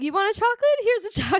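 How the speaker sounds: reenacted high-pitched offer; acted offer. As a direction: offer it up high, like handing out treats. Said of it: "So I was like".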